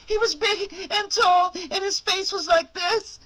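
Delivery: put-on high, eager child describing; a boy describing a monster; excited little boy.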